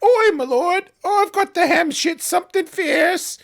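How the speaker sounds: put-on high, loud Cockney peasant accent; hammed-up Cockney peasant accent. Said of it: "especially if you do it with any sort of accent".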